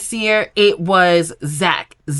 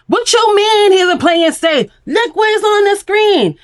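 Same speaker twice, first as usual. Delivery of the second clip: high, loud mock outburst from the girls; imitated shout; 11 semitones higher, 4 dB louder than usual. Islander shouting back at the host in mock outrage. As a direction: yell it back like an offended islander. Women yelling back at Corbin.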